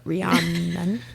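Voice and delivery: "Rhiannon" slowly as ree-AHN-un. Shown as a demonstration. Co-host practises "Rhiannon" slowly with an ah, ree-AHN-un. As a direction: stretch the open ah in "Rhiannon".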